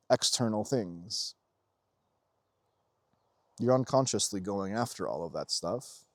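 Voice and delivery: usual speaking voice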